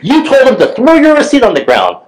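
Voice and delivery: high mock scold; the lady accusing him in front of his son; imitating accusing lady. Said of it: "And she's like".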